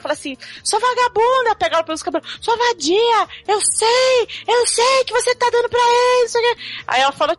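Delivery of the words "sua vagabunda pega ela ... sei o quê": high, hammed-up angry yelling; mock furious aunt. Aunt furious in retold story.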